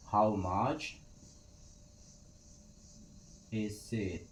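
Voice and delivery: words pulled apart with a long pause; slow, spaced teacher model. Shown as a demonstration. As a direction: model it slowly for learners. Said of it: "teacher models question".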